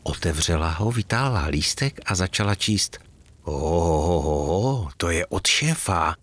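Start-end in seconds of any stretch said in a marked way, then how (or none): 3.4-6.1 excited guess. A narrator's reading. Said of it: "hádala nadšeně Katka".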